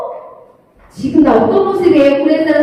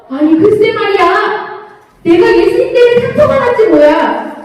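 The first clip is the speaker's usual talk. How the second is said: usual speaking voice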